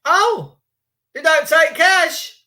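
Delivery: high, slow, incredulous; mock shock. Customer at the till hearing no cash, mock shock. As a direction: act stunned that cash is refused. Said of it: "customer shocked at no cash".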